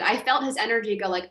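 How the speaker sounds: normal talking voice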